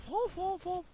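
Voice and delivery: high nonsense syllables. Retold or imitated. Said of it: "your dad goes".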